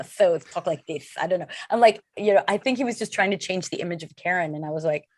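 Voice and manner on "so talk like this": "so talk like this" with th for every s: "tho talk like thith"; lisp. Retold or imitated. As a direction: lisp on "so" and "this", s turned to th. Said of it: "let's try a lisp".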